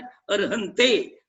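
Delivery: धीमा, अर्हंते का ए साफ़; अर्हंते को धीरे, शब्दांश अलग करके; सही उच्चारण. Shown as a demonstration. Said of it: "कैसे बोलना है?". (अर्हंते, धीमे धीमे सिखाते हुए)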